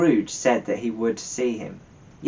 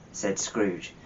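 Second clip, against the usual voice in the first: ordinary delivery, nothing marked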